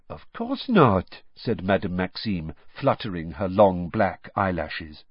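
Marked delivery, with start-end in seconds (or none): 0.1-1.2 put-on high, drawn-out French-accented reply; French headmistress. A narrator's reading. French headmistress replies coyly.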